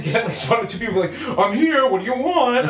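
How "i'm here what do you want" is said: high, louder mock-annoyed teammate; imitated exasperation. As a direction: play a teammate pestered into answering. Imitates irritated teammate finally answering.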